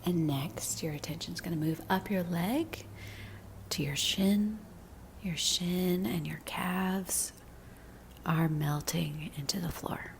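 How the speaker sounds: slow, soothing meditation voice with long pauses; unhurried guidance, letting each phrase settle; guided body scan meditation. Real, not acted. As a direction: lead them gently into relaxing, drawing out the phrases.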